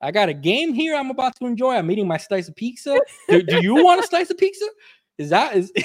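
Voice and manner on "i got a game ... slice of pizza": fast, higher, hammed-up outrage; indignant retold rant; mock indignant rant. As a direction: rattle it off, pretending offence. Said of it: "And I go".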